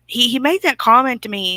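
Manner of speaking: normal talking voice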